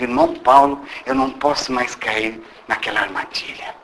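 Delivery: hushed, low plea on the verge of tears; quietly imitating a man about to cry; retold tearful plea. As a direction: voice sinking as he confesses. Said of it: "Ele falava isso e começou a chorar".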